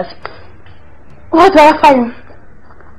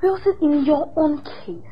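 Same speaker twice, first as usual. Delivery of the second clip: ordinary delivery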